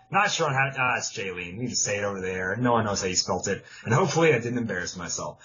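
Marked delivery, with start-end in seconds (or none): none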